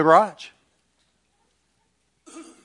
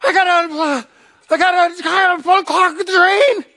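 high, loud, flustered stammering; frantic panic; 13 semitones higher, 5 dB louder than usual. Retold or imitated. Husband in his underwear explains the flood to his wife, frantic. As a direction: blurt it out in a high-pitched panic. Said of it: "I said".

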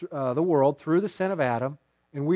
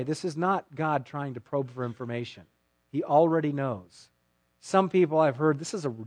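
usual speaking voice

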